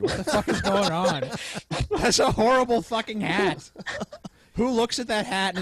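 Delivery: loud, laughing disbelief; incredulous laughing outburst. Real, not acted. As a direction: burst out laughing at the absurd hat. Mocking the hat, laughing.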